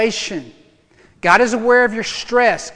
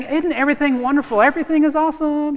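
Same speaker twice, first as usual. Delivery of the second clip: higher, fast, airy skipping voice; mock carefree singsong; 7 semitones higher, faster than usual. A carefree Jesus skipping through clovers, mock singsong. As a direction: play a naive cheery optimist. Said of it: "skipping through the clovers ... singing ... la la dance".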